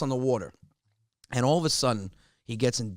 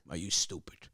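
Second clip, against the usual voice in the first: lower, softer deadpan dad; blunt father; 6 semitones lower, 8 dB quieter than usual. Retold or imitated. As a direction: drop the voice like a dismissive father. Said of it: "He goes".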